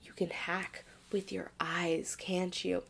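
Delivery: slow, secretive whisper; whispering quote. Retold or imitated. L-Man probing about the eye hacking, hushed whisper. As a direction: lean in and whisper it slowly. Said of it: "'cause L-Man's like".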